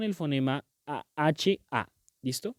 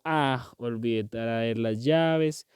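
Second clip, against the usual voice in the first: stretched-out "Ah, olvidé traer las llaves", x0.5 slow; slow dismayed ah; 3 semitones lower, much slower than usual. Shown as a demonstration. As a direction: drag it out like a sigh of dismay.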